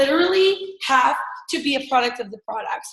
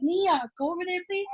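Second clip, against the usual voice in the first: quiet aside; 6 semitones higher, 6 dB quieter than usual. Real, not acted. Aside to child during call.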